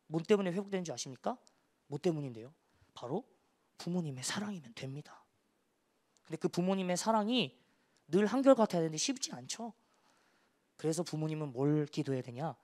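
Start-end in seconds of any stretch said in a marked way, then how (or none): none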